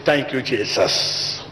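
slow, hushed thank you; grateful prayer. Retold or imitated. Believer quietly thanking Jesus, slow and soft. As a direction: breathe it out gratefully. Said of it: "all you need to say is".

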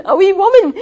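high, loud incredulous echo; astonished. Retold or imitated. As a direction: repeat it in total disbelief. Teacher's astonished retort.

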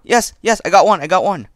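loud, high triumphant yell; excited. Real, not acted. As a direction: shout in triumph. Celebrating a kill.

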